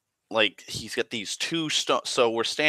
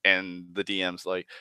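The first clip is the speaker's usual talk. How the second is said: ordinary talk, nothing marked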